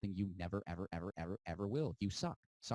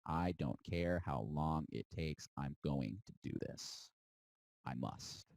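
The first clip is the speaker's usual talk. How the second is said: grim resolve; 4 semitones lower, slower than usual. Retold or imitated. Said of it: "you look them in the eye and you say".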